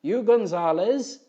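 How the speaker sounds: high, loud, slow call; hollered. Acted. Crewman hollering to Gribley over the helicopter, a high loud call. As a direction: bellow over rotor noise. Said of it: "he hollered to Gribley".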